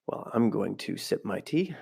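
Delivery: ordinary delivery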